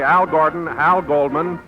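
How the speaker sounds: ordinary delivery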